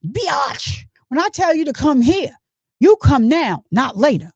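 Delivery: lightly voiced angry boyfriend ordering her. Mock angry boyfriend ordering his girlfriend, lightly voiced. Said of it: "Sound just like him, don't it?".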